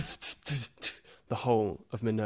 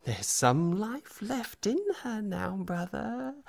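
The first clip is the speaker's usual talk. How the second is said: creepy whisper; 5 semitones higher, 7 dB louder than usual. Acted. GM voices the scarred figure.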